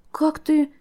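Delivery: high, astonished question; amazed gasp. A narrator's reading. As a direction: breathe it out in amazement. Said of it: "изумлённо выдохнула Лира".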